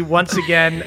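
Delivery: ordinary delivery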